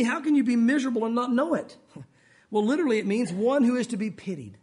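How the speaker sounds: normal talking voice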